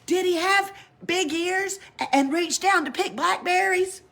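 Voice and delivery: high shouting call; shouted. A narrator's reading. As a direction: shout after the departing girl. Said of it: "but then Pippi shouted".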